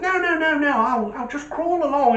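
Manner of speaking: high, quick denials; reassuring driver. Retold or imitated. Driver reassuring the old man about speed, high and brisk. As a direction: wave away his worries. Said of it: "And he goes".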